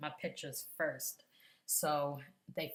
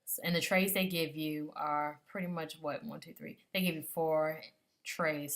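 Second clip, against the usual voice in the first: ordinary delivery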